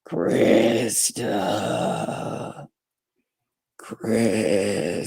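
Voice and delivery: low, eerie breathy call from the casket; ghostly whisper. Retold or imitated. Dead woman calling from the casket, eerie ghostly moan.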